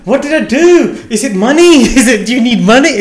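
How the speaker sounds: high, loud pleading as if being dumped; hammed-up breakup desperation, voice pitched right up; mock jilted panic. Retelling a partner's bad news like a breakup, mock panicked. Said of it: "like someone's breaking up with me".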